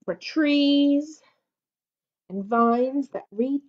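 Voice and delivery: storybook read-aloud; reading to children. Acted. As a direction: read it slowly to a little child. Reads picture book aloud.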